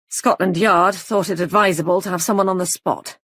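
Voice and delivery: brisk fast rapid-fire report; short explosive sentences. Acted. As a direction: rattle it off in quick bursts. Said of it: "spoke in short, explosive sentences".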